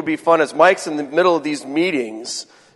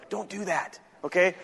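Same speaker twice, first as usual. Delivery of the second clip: soft warning; 11 dB quieter than usual. Shown as a demonstration. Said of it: "email etiquette lesson".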